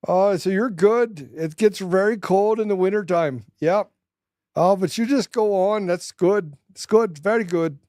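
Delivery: high, hammed-up Canadian folksy voice; mock Canadian accent. Canadian locals greeting invaders, hammed-up high accent. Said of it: "You're, you're better at accents than me".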